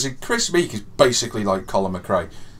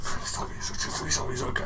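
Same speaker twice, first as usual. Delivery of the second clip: breathy pleading whisper, softer; whispered plea; 9 dB quieter, faster than usual. Retold or imitated. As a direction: beg under your breath, voiceless. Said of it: "I was like".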